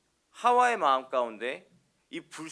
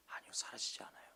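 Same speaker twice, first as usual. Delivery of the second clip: whisper; 16 dB quieter than usual. Real, not acted. Preacher answers own question softly.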